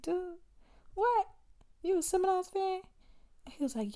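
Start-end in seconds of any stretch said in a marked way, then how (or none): 0.0-2.8 high-pitched mock disbelief; incredulous. Customer challenging a man over her jacket, high incredulous reply.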